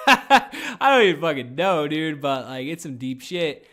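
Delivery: a real helpless laugh at himself, slowly. Real, not acted.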